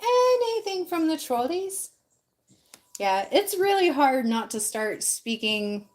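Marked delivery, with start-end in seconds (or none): 0.0-1.9 higher, slow British sweet-trolley call; a high, slow, put-on British accent; British trolley witch. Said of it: "speaking with a British accent".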